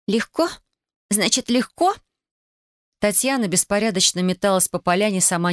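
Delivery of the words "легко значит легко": high, rising incredulous questions; indignant echo. A narrator's reading. Tatiana echoes Alexander's word, indignant. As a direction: throw the word back as a rising challenge.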